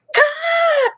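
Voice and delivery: very high, sharp excited gasp; a starstruck gasp of delight. Retold or imitated. Hearing that her idol posted about her, excited high gasp. Reacting to Leia's post.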